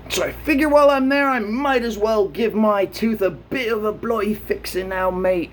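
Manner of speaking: hammed-up British voice; mock British accent. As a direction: play a cheeky Brit. Slips into put-on British accent.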